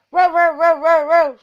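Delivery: high loud barking row row; dog barking. A narrator's reading. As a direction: bark it like an excited dog. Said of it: "said Bottomley Potts".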